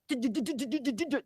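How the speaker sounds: fast rattling jackhammer noise; imitated jackhammer. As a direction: rattle it off like a machine drilling. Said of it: "And now they're like".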